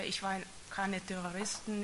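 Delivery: ordinary delivery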